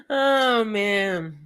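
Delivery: high laughing bursts between words; can't stop giggling; still laughing. Real, not acted. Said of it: "I'm cracking myself up".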